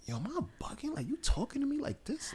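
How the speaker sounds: high incredulous whisper; mock hushed disbelief. Man recalling how his girlfriend spoke to him, incredulous whisper. Acts out his taken-aback reaction.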